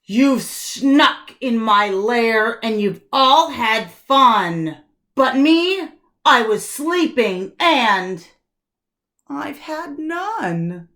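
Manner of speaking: a woken bear sulking at the party; grumbly bear. Acted. Grumpy bear complains.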